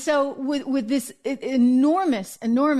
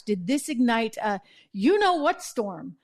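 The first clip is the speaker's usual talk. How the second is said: ordinary delivery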